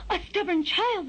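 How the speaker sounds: high, shocked gasp; gasp, then exclaim high in dismay; aghast mother bird. A narrator's reading. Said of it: "the mother bird gasped".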